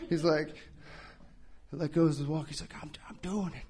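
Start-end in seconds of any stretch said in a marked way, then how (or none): 2.8-3.6 imitating a whisper. Old man whispering while walking.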